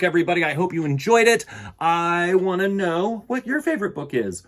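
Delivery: ordinary delivery, nothing marked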